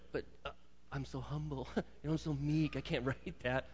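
hushed, laughing protest of false modesty; mock-humble Moses. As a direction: play Moses coyly refusing, softly with laughs. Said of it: "You can just see Moses going".

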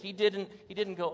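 ordinary delivery

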